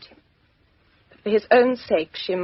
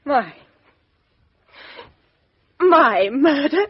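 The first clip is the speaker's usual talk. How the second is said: stunned disbelief; 5 semitones higher, slower than usual. Acted. Accused woman stunned by accusation.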